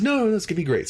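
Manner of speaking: high, cheerful mock eagerness; retold excitement. Eager cadet welcoming a cleaning job, in high mock excitement. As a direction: chirp it with hammed-up delight. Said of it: "She's really excited".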